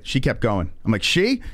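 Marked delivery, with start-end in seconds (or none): 1.0-1.4 high surprised "She?"; surprised question. Retold or imitated. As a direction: ask it in sudden disbelief. Surprised the driver was a woman.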